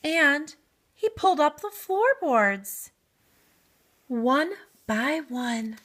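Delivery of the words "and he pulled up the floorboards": ordinary delivery, nothing marked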